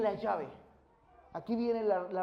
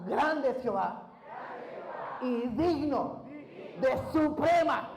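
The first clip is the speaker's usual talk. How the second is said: leading praise; 3 semitones higher, 4 dB louder, much slower than usual. Shown as a demonstration. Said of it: "Diga conmigo".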